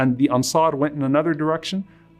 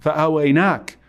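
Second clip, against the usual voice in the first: impassioned preaching; 4 semitones higher than usual. Acted. Preacher voicing the Prophet's reply to the Ansar, impassioned. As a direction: preach it with rising passion, pleading and proud.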